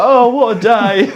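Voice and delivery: put-on high, slow, laughing baby voice; mock newborn voice. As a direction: squeak it out like a big baby. Voicing Harvey being born.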